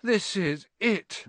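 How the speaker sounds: high, slow despairing moan. Acted. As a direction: moan it as if all is lost. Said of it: "Ron moaned".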